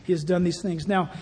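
ordinary talk

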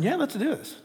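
a lightly voiced, soft, coaxing Spirit; soft, egging-on invitation. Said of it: "the Spirit's going".

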